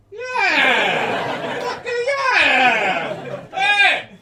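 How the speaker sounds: high, loud gleeful yelling; excited whoop. Retold or imitated. Comic at his own toilet, loud excited whoop. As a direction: shout with childish delight. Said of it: "I went from kind of shock to sort of".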